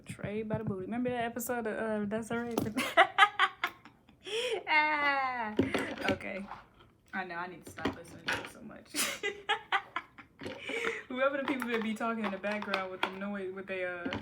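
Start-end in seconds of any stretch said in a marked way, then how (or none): 2.6-3.9 loud high cackle; high burst of laughter. Real, not acted. Remembering a funny episode, loud high laugh. 9.3-11.0 breathy laugh. Real, not acted.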